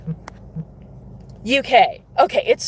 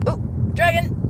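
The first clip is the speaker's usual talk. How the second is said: excited spotting; 9 semitones higher than usual. Real, not acted. Spotting a dragon.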